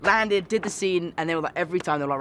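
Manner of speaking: ordinary talk, nothing marked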